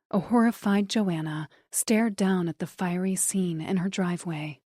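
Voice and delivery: plain reading voice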